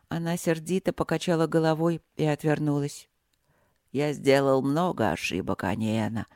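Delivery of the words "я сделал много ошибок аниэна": low gentle rumble; soft rumble. A narrator's reading. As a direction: rumble it softly, apologetic. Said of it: "пророкотал владыка мягко".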